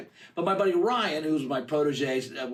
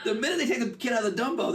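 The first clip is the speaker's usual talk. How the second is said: normal talking voice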